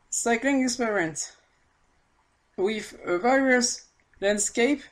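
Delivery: slow, careful English with a French accent; laboured English. Real, not acted. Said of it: "pour articuler en anglais c'est compliqué".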